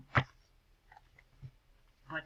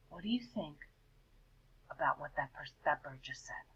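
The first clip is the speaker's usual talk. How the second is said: hushed, breathy question; whispered aside; 4 semitones lower, 10 dB quieter than usual. Real, not acted. Teacher whispering a question to the children. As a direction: whisper it to the class.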